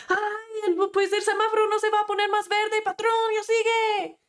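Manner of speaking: high-pitched, fast mock road rage; hammed-up shouting at the car ahead, no real volume; angry yelling. Man stuck in traffic yelling at another driver, mock angry. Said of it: "está enojado ... y está gritando y dice".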